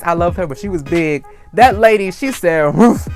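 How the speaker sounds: usual speaking voice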